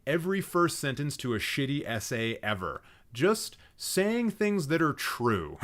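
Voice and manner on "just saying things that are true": slow, higher mocking read; mocking drawl. Real, not acted. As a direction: drag it out, poking fun. Mocking the essay's opening line.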